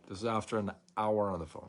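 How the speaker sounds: ordinary talk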